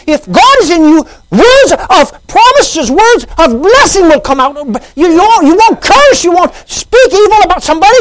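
loud, high preaching build; full-force sermon climax; fiery preaching. Acted. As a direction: preach it hard to the crowd.

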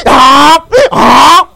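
loud high furious yells; angry yells. Retold or imitated. Man beating his stuck donkey, angry yells. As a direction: shout out in rage, twice.

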